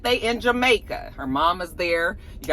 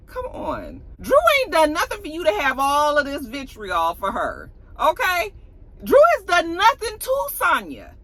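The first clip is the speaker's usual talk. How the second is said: exasperated scolding; 7 semitones higher than usual. Real, not acted. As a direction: tell her off, exasperated. Scolding Sonya directly, exasperated.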